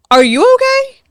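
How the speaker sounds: very high, loud indignant question; incredulous. Retold or imitated. Confronting the girl who is harassing her, incredulous. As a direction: shoot way up in outraged disbelief.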